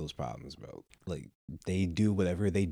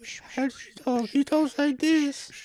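put-on very high, slow creepy old man voice; Family Guy old man voice; much slower than usual. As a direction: play the creepy old neighbor, high and drawn out. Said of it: "I sound like the old guy from Family Guy".

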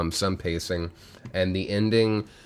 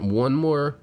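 usual speaking voice

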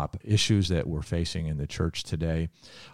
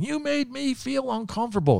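much higher-pitched mock of her complaint; mocking angry woman. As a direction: parody her offended whine, way up high. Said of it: "continued to tell him off".

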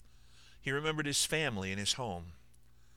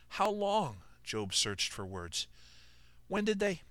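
confused; 9 semitones higher, slower than usual. Acted. Job struggles for words.

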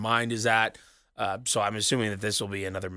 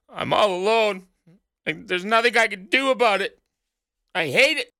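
mock lament; 10 semitones higher, 4 dB louder than usual. Mock lament about being alone.

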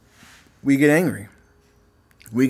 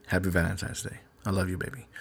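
tender dedication; 4 semitones lower, 9 dB quieter than usual. Real, not acted. Greeting his wife on Valentine's.

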